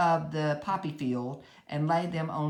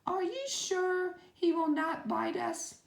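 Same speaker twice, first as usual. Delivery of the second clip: much higher, small nervous squeak; timid mouse voice; 13 semitones higher than usual. A narrator's reading. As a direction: ask shyly from hiding in the grass. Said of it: "asked in a timid voice".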